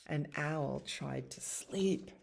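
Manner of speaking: usual speaking voice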